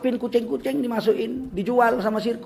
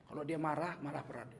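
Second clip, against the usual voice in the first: much softer, dropping to a hush; quiet repeat; 4 semitones lower, 14 dB quieter than usual. Shown as a demonstration. Preacher repeating that the brother-in-law gets quiet when angry, hushed. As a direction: say it again barely above a murmur.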